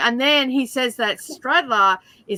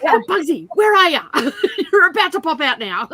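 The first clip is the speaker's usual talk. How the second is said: a hint of a hammed-up gangster, louder and higher; mock gangster calling for Bugsy, laughing; mafia movie voice; 7 semitones higher, 6 dB louder than usual. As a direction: lightly play a B-movie mobster calling out. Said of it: "a very, very low-grade mafia movie".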